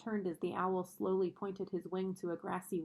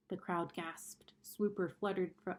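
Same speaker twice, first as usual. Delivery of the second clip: plain reading voice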